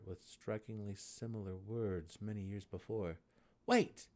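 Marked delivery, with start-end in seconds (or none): 3.7-4.1 Drizzt calls out loud and high; a sharp high call to stop him. A narrator's reading. Drizzt calls the departing gnome back, loud. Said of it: "Drizzt called".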